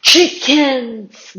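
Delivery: loud scornful bellow. A narrator's reading. Giant mocking the frightened soldiers, bellowing. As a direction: bellow the insult. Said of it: "Goliath bellowed".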